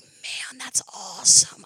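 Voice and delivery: awed hush, breathy and voiceless; whisper. Retold or imitated. Remembering the church interpreters, an awed whisper. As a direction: marvel at it under your breath. Recalling awe at deaf ministry.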